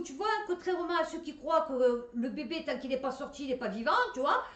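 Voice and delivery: ordinary delivery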